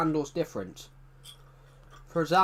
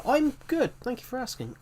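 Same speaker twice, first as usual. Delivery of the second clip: high silly voice; 10 semitones higher than usual. Pretend. Answering chat in silly voice.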